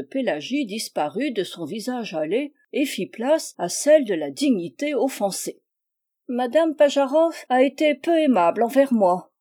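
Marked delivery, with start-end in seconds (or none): 6.3-9.3 prim complaint, higher and louder; pinched, offended dignity. Acted. Pélagie to the diplomat about her neighbour, prim and offended. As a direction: sniff out the grievance with offended dignity. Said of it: "de cet air pincé".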